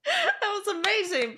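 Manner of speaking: high laughing praise. Real, not acted. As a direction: laugh through the words. Laughing after the bit.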